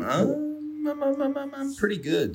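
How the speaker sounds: high, stammering, nervous squeak; squeaky high voice. Pretend.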